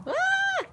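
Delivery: high sharp yelp; sudden frightened cry; startled shriek. Real, not acted. Startled by chickens.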